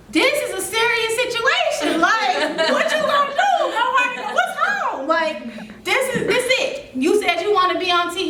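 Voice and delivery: high-pitched mock wail to yourself; retold crying. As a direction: plead with yourself, voice shooting up high. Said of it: "I'm telling you, I'm crying".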